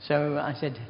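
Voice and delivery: usual speaking voice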